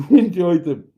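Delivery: "Enjoy the" sung out high and loud in a hammed-up voice; terrible put-on voice. Shown as a demonstration. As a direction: ham it up badly on "Enjoy the". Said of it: "terrible voice now".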